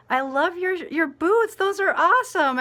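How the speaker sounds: high, loud, gushing praise. Retold or imitated. She compliments a little girl's snow boots, excited and gushing.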